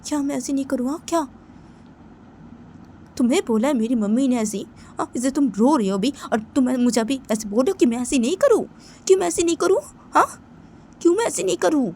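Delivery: loud, high, indignant demands; angry child character. Pretend. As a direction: shout it at your friend, offended. Doll character confronts her friend.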